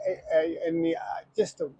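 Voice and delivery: halting, tripping over words; stuttering. Real, not acted. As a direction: stumble and restart each word. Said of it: "I'm stuttering".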